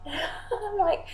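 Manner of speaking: laughing. Real, not acted. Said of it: "laugh heard".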